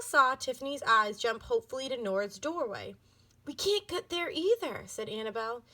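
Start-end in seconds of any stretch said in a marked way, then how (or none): 3.5-4.8 panicky. A narrator's reading. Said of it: "She was feeling panicky".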